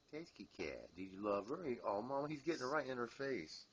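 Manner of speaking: ordinary delivery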